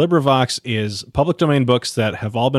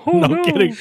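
squeaky voice; 3 dB louder than usual. Pretend. Reacting to cartoon voice.

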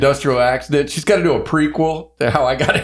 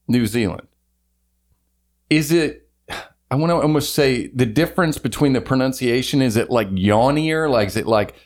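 ordinary talk, nothing marked

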